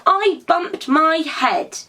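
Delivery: a grumpy small rabbit complaining; cross little voice. A narrator's reading. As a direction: rabbit stuck in the well, cross and slow. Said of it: "A rather cross little voice answered".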